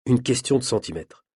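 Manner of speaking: plain reading voice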